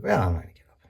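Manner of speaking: lazy whispered refusal. Retold or imitated. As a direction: breathe it out without voice, unwilling to move. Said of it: "You're like".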